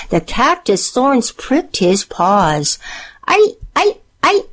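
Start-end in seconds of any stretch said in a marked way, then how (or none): 3.3-4.4 high-pitched, acted little yelps; pained yelp. A narrator's reading. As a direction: yelp as if stepping on thorns. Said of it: "he yelped as he tiptoed across the roof".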